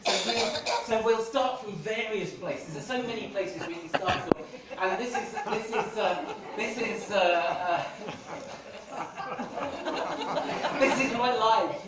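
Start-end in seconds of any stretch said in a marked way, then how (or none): 4.8-11.9 laughing through the words; giggling while trying to speak. Real, not acted. Laughing while showing poster.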